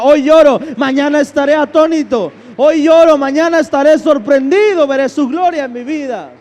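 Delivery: preacher roaring faith's declaration, building to a shout. Acted. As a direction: climb until you are shouting it. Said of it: "la fe profunda que dice".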